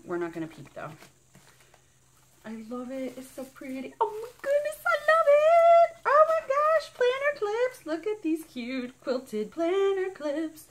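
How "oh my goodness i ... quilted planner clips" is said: very high, loud squeal of joy; squealing delight. Real, not acted. Finding cute planner clips for viewers, high squeal. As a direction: gush over the clips.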